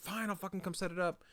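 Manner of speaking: high, grumbling, put-upon mock teen reply; sulky imitated sigh of compliance; grudging reply. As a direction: grumble it like a teen dragged away. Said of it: "I was like".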